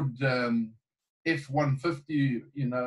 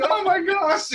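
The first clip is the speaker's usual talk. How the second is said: laughing; 11 semitones higher, slower than usual. Real, not acted. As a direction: burst out laughing at the idea. Laughing at the flush meter.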